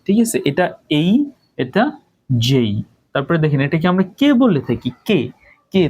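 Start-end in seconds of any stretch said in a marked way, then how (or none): 2.3-2.7 "জেই" with an ay glide at the end; correct letter J. Shown as a demonstration. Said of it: "but এটার উচ্চারণ হবে". ("জেই" শেষে ই-ধ্বনিতে মিলিয়ে)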